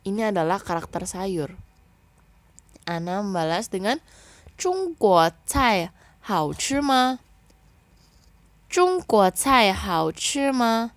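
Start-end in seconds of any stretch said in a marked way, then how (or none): 4.3-11.0 very slow, higher and louder Mandarin question; slow model reading. Shown as a demonstration. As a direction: read it out clearly to the class. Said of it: "Kita baca ya".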